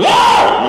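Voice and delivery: sudden loud "Ah!" yell; jeritan mengejut. Shown as a demonstration. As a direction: startle the dozers with one sharp burst. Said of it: "kalau saya ceramah, memang lantang". (sergahan kuat secara tiba-tiba)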